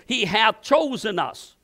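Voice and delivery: usual speaking voice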